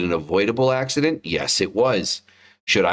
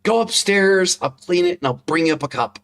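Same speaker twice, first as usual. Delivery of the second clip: forced calm; 12 semitones higher than usual. Retold or imitated. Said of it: "I'm like".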